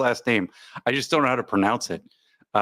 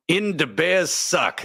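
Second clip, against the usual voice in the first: slow, higher mock Wisconsin accent; 5 semitones higher, much slower than usual. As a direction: stretch it out in a put-on Wisconsin accent. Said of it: "That's how you say it in Wisconsin".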